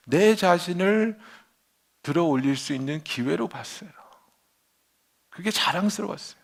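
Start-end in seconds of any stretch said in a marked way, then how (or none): none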